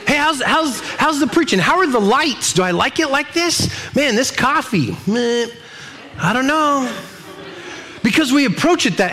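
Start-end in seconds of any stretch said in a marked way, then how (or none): 0.1-6.9 higher, mock whiny critic; consumer churchgoer. Churchgoer rating preaching, lights and coffee, higher mock whine. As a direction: sniff at everything like a picky diner. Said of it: "we think things like that".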